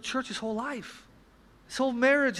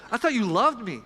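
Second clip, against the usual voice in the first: higher and louder, hurt anger rising; angry complaint to God; 6 semitones higher, 4 dB louder than usual. Retold or imitated. The angry voice in our head accusing God, hurt and loud. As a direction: let the hurt boil up toward God. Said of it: "Anger says".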